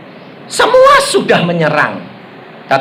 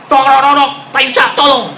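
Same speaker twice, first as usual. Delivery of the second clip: higher-pitched urgent plea; retold cry for help; urgent call for help; 6 semitones higher, faster than usual. Retold night-time call for help.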